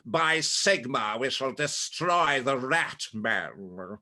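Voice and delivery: slow, dying, haughty hero declaration; very slow, grandly arrogant battle cry; arrogant Victor voice. Shown as a demonstration. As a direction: boom it out like a doomed arrogant witch hunter. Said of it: "there's a dying tone to it".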